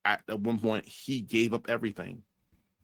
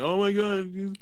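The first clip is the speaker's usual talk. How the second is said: higher mock scandalized gasp; mock outrage; 7 semitones higher than usual. The audience mocked for reacting to a woman, higher mock outrage. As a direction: fake being shocked and outraged. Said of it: "They went".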